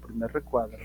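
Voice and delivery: ordinary talk, nothing marked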